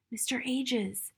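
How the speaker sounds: calling softly to a friend in the shadows. A narrator's reading. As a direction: call his name gently without being overheard. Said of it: "she called softly".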